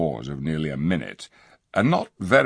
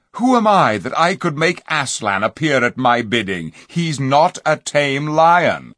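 solemn king; 8 semitones higher, 6 dB louder than usual. A narrator's reading. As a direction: proclaim it gravely.

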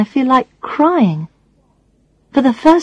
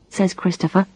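plain reading voice

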